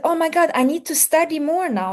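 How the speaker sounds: high, loud panicked rush; imitating a panicking client; panicky client. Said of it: "And she was freaking out".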